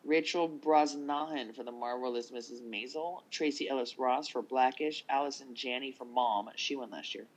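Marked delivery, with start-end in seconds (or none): none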